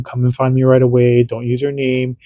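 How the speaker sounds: normal talking voice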